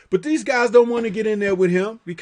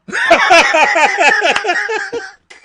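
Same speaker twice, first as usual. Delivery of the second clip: high, loud burst of laughter; cracking up hard; 13 semitones higher, 6 dB louder than usual. Real, not acted. Laughing at the fight.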